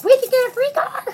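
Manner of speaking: high, loud thrilled cry; excited kids. Retold or imitated. Nieces and nephews cheering about the car, high and loud. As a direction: bounce with excitement.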